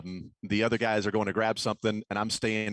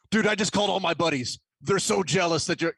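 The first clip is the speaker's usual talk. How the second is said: higher-pitched excited quote; excited buddy; 6 semitones higher than usual. Retold or imitated. Buddy bragging he called all his friends, excited. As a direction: gush like a thrilled friend.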